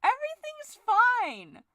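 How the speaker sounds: very high, slow sung okay; manic cheer. Acted. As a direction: cover the anger with a bright high sing-song. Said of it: "Falky's going insane".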